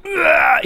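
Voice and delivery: high, drawn-out "ugh"; mock groan of someone choking on smoke; disgusted groan. Passenger fed up with the smoke, mock disgusted groan.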